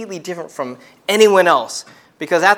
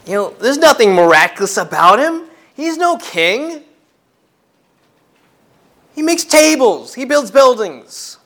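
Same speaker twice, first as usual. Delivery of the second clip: higher, louder mocking villagers; scornful townspeople; 5 semitones higher, 4 dB louder than usual. Preacher voicing the hometown crowd scoffing at Jesus, high and loud. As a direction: sneer it like scoffing neighbours.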